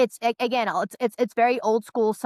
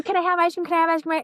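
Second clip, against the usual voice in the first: put-on pitched-up, nagging kid voice; whiny kid; 9 semitones higher, 4 dB louder, faster than usual. Mother imitating kids begging for ice cream. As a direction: mimic a child begging over and over. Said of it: "As opposed to".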